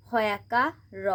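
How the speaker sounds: ordinary talk, nothing marked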